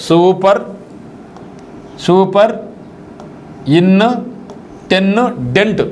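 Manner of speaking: Super, ఇన్, ten, dent said as separate chunks with pauses between; split into syllables. Shown as a demonstration. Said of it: "అట్లా మీరు నరికితే దాన్ని".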